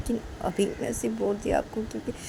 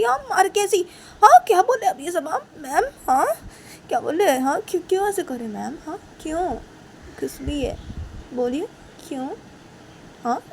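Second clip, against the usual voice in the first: higher, louder bewildered pleading; upset student; 7 semitones higher, 7 dB louder than usual. Acted. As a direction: press her with confused questions. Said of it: "तो काजल बोलती".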